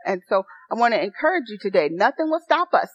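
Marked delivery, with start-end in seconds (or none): none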